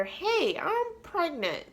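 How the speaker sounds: high, soft singsong reveal; mock casual announcement. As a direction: break the news in a high little voice. Acting out dreaded announcement to mom.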